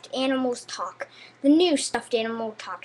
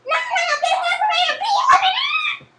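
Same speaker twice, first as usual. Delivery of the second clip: laughing evil shout; 7 dB louder than usual. Acted. Toy declares humans eliminated.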